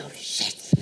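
whispered curse under the breath; startled hush, the curse kept secret; panicked aside. Retold or imitated. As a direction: whisper it so nobody hears. Sudden panic at seeing Oprah.

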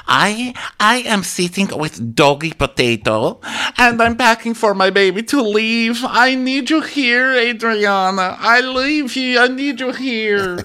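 high, slow, pleading mock voice; mock whiny friend. Julia begging Adriana over FaceTime, high and slow. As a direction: whimper it out like a clingy drama queen. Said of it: "and she's like".

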